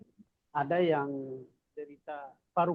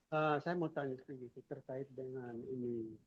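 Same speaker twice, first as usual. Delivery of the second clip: too quiet; 10 dB quieter than usual. Real, not acted. Audience member asking the doctor about Ganoderma, too quietly. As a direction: ask it softly, barely carrying. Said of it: "suaranya lebih keras".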